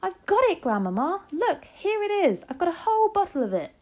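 high, loud, jubilant; jumping-up-and-down excitement; excited cry. A narrator's reading. Child showing grandmother the bottle, crying out excitedly. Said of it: "I cried, jumping up and down".

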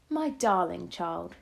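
slow and wondering; slow, thoughtful grandmother; slowly. Acted. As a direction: draw each word out, amazed. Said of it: "she said slowly".

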